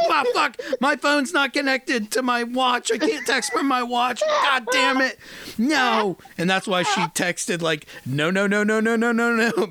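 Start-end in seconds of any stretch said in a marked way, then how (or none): 0.1-6.2 higher-pitched retelling of an exasperated wife; panicked frustration, imitated. Acting out wife's panicked frustration. 8.1-9.7 rapid-fire string of "no"s, rising higher; frantic denial, imitated. Wife's frantic texted denial acted out.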